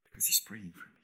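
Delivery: soft, whispered truth. Real, not acted. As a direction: whisper it close. Tender climax of sermon.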